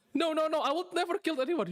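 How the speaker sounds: desperate high-pitched protest; panicked denial. Acted. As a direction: plead innocence in a panic. Accused man denies murder.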